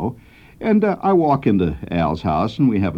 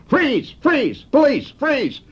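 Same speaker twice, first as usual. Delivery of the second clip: much higher cop yell of freeze; imitating a holler; slower than usual. Kid whirling round and yelling at the narrator, mock cop holler. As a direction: yell it like a cop drawing on you. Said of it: "he hollered".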